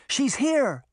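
high, bright call of relief; excited cry. Acted. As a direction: call out she's found. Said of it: "Daphne cried".

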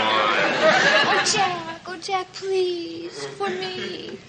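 slow, drawn-out wheedle, softening at the end; pleading. Acted. Chrissy begs Jack to call Janet for her, wheedling.

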